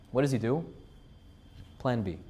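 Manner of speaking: ordinary delivery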